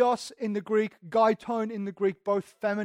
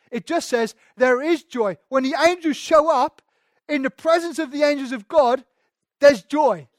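louder and higher, rising; building to a shout; 4 semitones higher, 7 dB louder than usual. Real, not acted. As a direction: preach it with growing fire. Building sermon climax.

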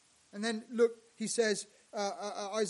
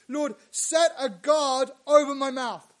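loud plea; 5 semitones higher, 12 dB louder than usual. Retold or imitated. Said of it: "he says".

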